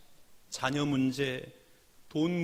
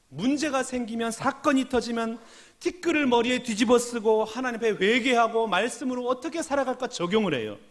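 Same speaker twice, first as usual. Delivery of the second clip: ordinary talk, nothing marked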